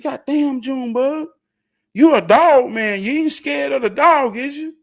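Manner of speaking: high, loud mocking call; teasing shout. Teasing Junebug about fearing dog.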